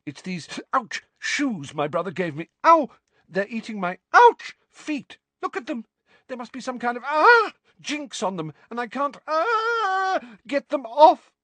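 high, strained, wincing wizard; pained wizard voice. Acted. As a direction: yelp through the pain of burning feet. Jinxed wizard in pain.

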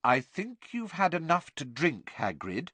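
high-pitched, firm scolding; firm, prim admonishment. A narrator's reading. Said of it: "said Hermione firmly".

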